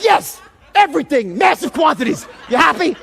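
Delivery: loud, high, fed-up retort; acted-out sarcastic confession at full volume; exasperated outburst. Fuming applicant answering the insurance woman, exasperated. Retold angry answers on phone call.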